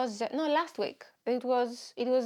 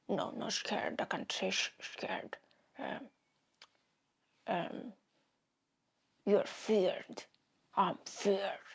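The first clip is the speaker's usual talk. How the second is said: lisping Batman; 3 semitones lower, slower than usual. Retold or imitated. Batman practicing lisp.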